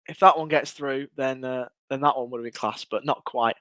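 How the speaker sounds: worn-out, fading voice; hoarse from shouting all match; losing voice. Real, not acted. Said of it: "I'm losing my voice".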